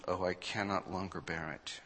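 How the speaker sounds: normal talking voice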